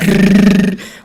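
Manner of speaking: loud, high rattling noise made by mouth; phone noise imitation. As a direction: imitate furious clicking on an old phone. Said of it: "on the phone thing".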